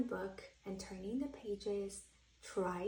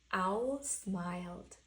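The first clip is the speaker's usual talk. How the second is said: ordinary delivery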